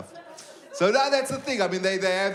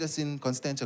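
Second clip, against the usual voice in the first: low, soft, matter-of-fact reply; quiet answer; 5 semitones lower, 7 dB quieter than usual. Retold or imitated. Caller tells call centre his suburb, low and quiet. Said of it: "I said".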